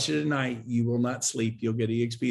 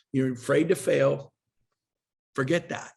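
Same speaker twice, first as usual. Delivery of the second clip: normal talking voice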